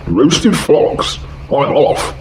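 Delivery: high, startled fox; high alarmed fox. Acted. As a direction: fox scared off, voiced high. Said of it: "fox alarmed".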